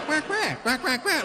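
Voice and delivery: high, rapid run of duck quacks; comic high-pitched quacking; answering duck. Retold or imitated. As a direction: quack back like the other duck. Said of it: "And the other one looks up".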